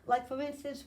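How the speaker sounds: ordinary delivery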